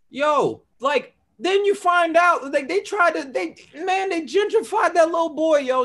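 high, loud incredulous outburst; excited. Real, not acted. Friend rants high and loud about gentrified boy, excited. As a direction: rant it up high in disbelief.

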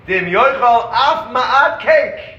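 slow solemn recitation of the text; emphatic quoted ruling. Retold or imitated. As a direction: intone the ruling with mock gravity. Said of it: "he says".

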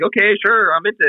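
naive eager voice. Retold or imitated. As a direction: play your clueless younger self. Naive past self agreeing.

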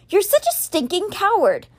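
high-pitched, indignant insult; disgusted anger. A narrator's reading. Alex insults his brother, disgusted and angry. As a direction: spit the insult out, pitch jumping up. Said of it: "feel the bile rising to his throat".

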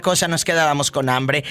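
ordinary talk

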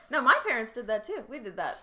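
ordinary delivery

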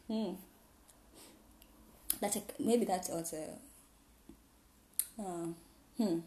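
ordinary talk, nothing marked

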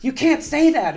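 very high, loud reprimand; imitated outraged scolding. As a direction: shout him down like a flustered attendant. Lady reprimands passenger, retold.

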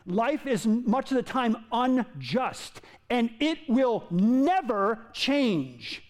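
normal talking voice

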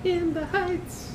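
falsetto sing-song. Pretend. Echoing movie title.

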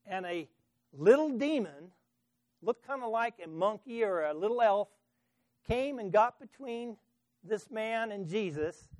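normal talking voice

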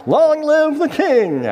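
high, slow rallying shout. Acted. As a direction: cheer it like a crowd. Crowd shouting at coronation.

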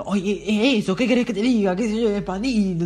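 dad losing patience, shouting the answer; mock dad shout. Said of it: "me grita". (papá gritando impaciente, más agudo y fuerte)

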